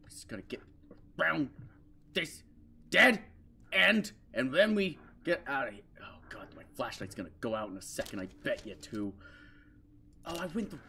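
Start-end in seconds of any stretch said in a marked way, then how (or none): 6.0-9.1 soft, fast whisper. Real, not acted. Player whispers fearing the flashlight fails.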